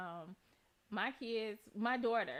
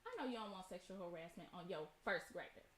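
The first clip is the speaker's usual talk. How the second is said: calm firm warning; 4 semitones lower, 9 dB quieter than usual. Retold or imitated. Calm firm warning to other mother.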